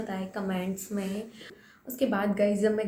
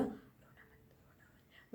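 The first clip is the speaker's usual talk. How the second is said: very soft, slow whisper; whispered quote; 18 dB quieter, much slower than usual. Retold or imitated. Star mouthing from the car not to cry, whispered. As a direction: hush it like mouthing through glass. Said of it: "SK ने मुझे बोला".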